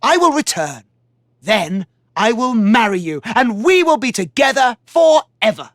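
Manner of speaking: confident promise. Acted. Warrior vowing to return to his love, confident. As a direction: promise her with hope. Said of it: "tried to reassure her".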